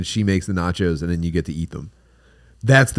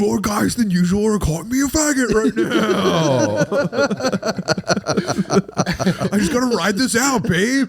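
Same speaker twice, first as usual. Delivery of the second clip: whiny stressed guy; 11 semitones higher than usual. Pretend. Man complaining to partner about troll insults, high and whiny.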